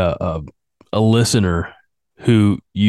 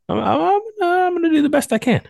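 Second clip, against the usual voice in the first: high squeaky voice; faster than usual. Pretend.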